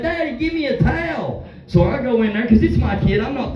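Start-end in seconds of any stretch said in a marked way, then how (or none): 0.0-1.5 son's voice. Retold or imitated. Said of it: "he's like".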